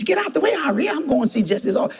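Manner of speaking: put-on high, sharp old lady voice pushing past; high cracking granny voice; old woman.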